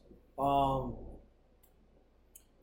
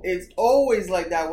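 ordinary talk, nothing marked

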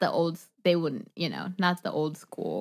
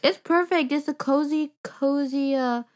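gushing; 10 semitones higher than usual. Real, not acted. Gushing about the movie.

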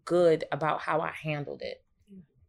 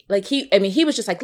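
usual speaking voice

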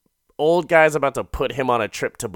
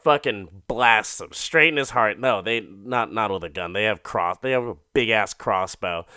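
ordinary talk, nothing marked

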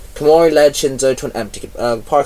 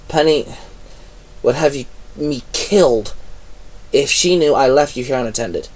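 ordinary delivery

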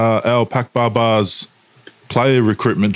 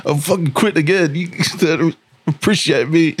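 higher, hammed-up sulky whine; mock sulky quitter; 7 semitones higher than usual. Playing along with his co-host's teasing, higher mock sulk. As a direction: pretend to storm off in a huff, whining.

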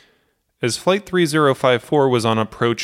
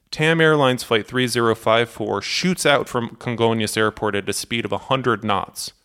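ordinary delivery

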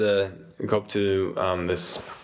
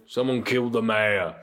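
matter-of-fact bruiser, near usual pitch; understated tough-guy statement; bruiser breaking news. Acted. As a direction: deliver it plainly as a tough. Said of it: "He goes".

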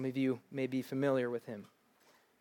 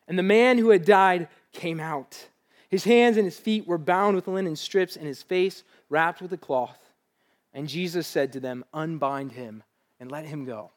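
preaching scripture reading; 3 semitones higher, 7 dB louder than usual. Acted. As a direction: proclaim the miracle to the room. Reads scripture at full force.

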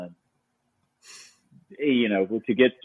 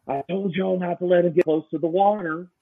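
Southern accent imitation; 8 semitones higher than usual. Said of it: "like Sam said".

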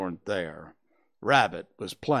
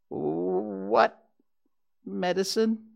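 high small voice, broken by a pause; wary question with a rising end; alarmed Piglet; 9 semitones higher, much slower than usual. A narrator's reading.

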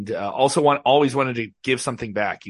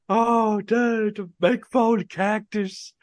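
higher, slow raspy Texas drawl; imitating a raspy Texas wrestling legend, higher and slow; Terry Funk Texas accent; 8 semitones higher, much slower than usual. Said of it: "like that accent that you would just hear".

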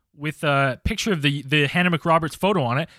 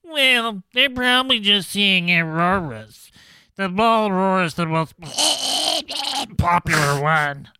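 slow, drawled rural witness voice; a light take on the interviewee; imitating documentary guy; 2 semitones higher, slower than usual. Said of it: "That's what this guy sounds like in the documentary".

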